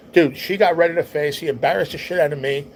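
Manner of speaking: plain reading voice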